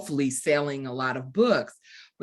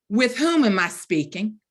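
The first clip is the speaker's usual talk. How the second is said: crisp formal demand, higher and louder; haughty challenge; 5 semitones higher, 5 dB louder than usual. Retold or imitated. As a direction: answer the caller with mock-grand suspicion. Retelling her cranky phone answer.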